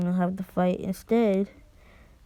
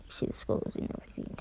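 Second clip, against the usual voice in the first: spooky whisper; 12 dB quieter, faster than usual. Acted. Eerie aside on the mindscape.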